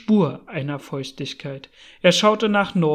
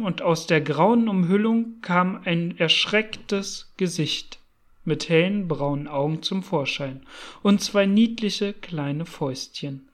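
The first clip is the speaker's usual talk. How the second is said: usual speaking voice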